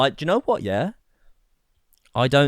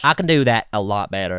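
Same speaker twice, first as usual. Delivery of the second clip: Southern US accent; 7 dB louder than usual. Shown as a demonstration. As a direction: boast in a Southern American accent. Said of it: "the South of America".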